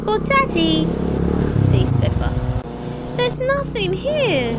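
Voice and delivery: put-on high, loud little girl voice; puzzled little piggy; Peppa. A narrator's reading. Peppa puzzling to Daddy, high and loud.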